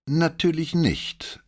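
high, drawn-out superior refusal; blasé arrogance. A narrator's reading. Cadet turning down a lawyer, blasé and superior. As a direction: play the cocky young war-film hero, slowly. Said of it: "mit der blasierten Überheblichkeit".

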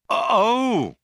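high, loud startled oh; guilty start. A narrator's reading. Zaphod remembers meeting Arthur, with a guilty start. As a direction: caught out, pitch leaping up. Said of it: "with a guilty start".

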